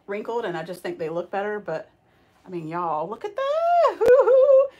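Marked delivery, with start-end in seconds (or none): 3.1-4.7 high, loud whooping delight; thrilled whoop. Real, not acted. Crafter shows viewers the stitched logo, whooping with joy. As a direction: whoop like you just won.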